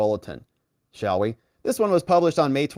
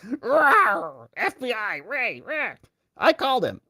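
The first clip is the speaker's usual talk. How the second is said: higher, hammed-up growl of a pumped-up politician; mock tough guy; 7 semitones higher, slower than usual. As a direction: charge in like a cartoon tough guy. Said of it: "put him back in and he's".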